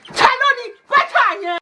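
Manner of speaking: high, loud Yoruba scolding; angry mum's shout. Retold or imitated. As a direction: imitate an irate mum yelling. Mum yelling question again.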